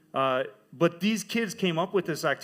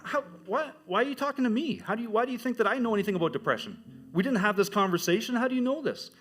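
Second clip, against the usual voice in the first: higher, bewildered questions; stunned disbelief; 8 semitones higher than usual. Retold or imitated. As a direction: throw up the pitch in shock, baffled. Stunned reaction to girl's confession.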